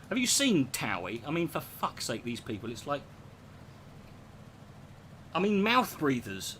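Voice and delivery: normal talking voice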